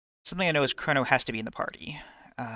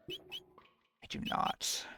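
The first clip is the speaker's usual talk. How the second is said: whispering; 10 dB quieter, much slower than usual. Real, not acted. Whispered aside to self.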